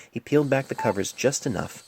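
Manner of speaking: ordinary delivery, nothing marked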